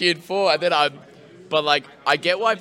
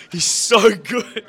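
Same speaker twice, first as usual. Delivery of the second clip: mock admiring; 6 semitones higher, 7 dB louder, much slower than usual. Mocking admiring voice.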